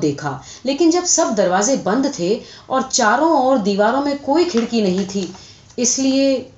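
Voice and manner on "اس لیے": ordinary delivery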